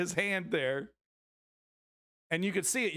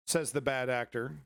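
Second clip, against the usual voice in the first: ordinary talk, nothing marked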